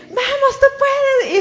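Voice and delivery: high, loud cheer; cheering shout. Retold or imitated. Runner cheering the six hundred behind him, loud high shout. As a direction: shout encouragement back down the course. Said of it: "se puso a gritarle".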